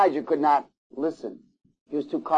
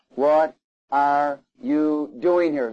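very slow, spaced-out question; God's question, weighed word by word; slow, deliberate; much slower than usual. Acted. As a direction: draw out each word of the question. Voices God questioning Elijah in cave.

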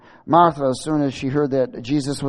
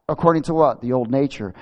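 ordinary delivery

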